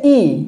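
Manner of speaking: ই drawn out slowly as a long "ee", falling; isolated vowel sound. Shown as a demonstration. Teacher showing learners the sound of letter I, ই held out by itself. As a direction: hold ই alone as a pure vowel. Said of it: "যেটা আমরা I বলি".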